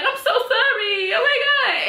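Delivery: high, slow, half-laughing sorry; breathless mock apology; mock apologetic gush. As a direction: gush it out like a shocked girl. [name] snapping out of it.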